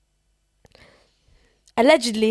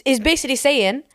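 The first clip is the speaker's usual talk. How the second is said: ordinary delivery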